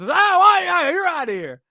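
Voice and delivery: high, mocking ejection call; lightly hammed-up umpire yelling you're outta here. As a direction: jeer it like a gleeful umpire.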